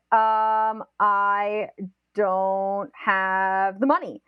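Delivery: drawn-out words, stalling as you go; hesitant excuse. Retold or imitated. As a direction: imitate someone slowly groping for an excuse. Offering another weak excuse, hesitating.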